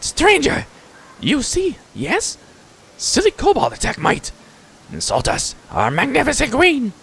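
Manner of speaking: high-pitched agitated creature voice; frantic little mite, very high. Acted. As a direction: squeal it like a tiny creature in distress. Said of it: "The little mite jumps in place".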